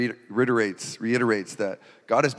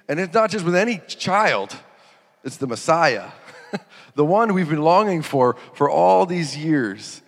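laughing; 4 semitones higher, 5 dB louder than usual. Real, not acted. Laughing at the thought.

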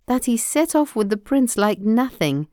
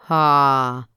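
a low, loud giant's "Ha"; giant's roar; 5 semitones lower, 4 dB louder than usual. A narrator's reading. Giant roaring as he smells blood in the house. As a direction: bellow it out like a huge ogre. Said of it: "roared the giant".

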